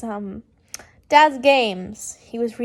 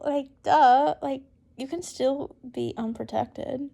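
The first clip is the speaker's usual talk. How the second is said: usual speaking voice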